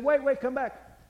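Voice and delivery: loud, high call to his wife; husband calling his wife back from the kitchen. Retold or imitated. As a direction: shout her back before she goes.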